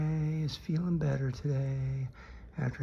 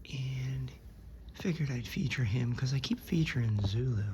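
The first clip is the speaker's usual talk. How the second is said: normal talking voice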